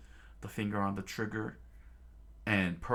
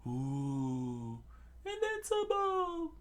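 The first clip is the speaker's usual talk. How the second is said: high mocking voice; much slower than usual. Crew mocks.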